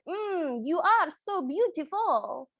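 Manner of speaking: put-on slow, savoring Italian accent; an Italian suitor's swoon after a chef's kiss. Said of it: "that was in an Italian accent".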